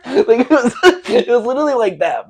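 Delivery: high, loud laughter through the words; cracking up while talking; laughing. Real, not acted.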